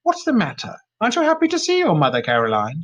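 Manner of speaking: high mocking sneer; sneering mother, mocking. A narrator's reading. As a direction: taunt her daughter with a sneer. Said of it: "she sneered".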